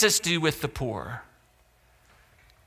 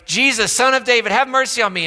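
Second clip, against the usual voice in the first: loud pleading cry for mercy; hollering; 8 semitones higher, 7 dB louder than usual. Retold or imitated. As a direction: call out desperately from the roadside. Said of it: "he was hollering on the side of the road".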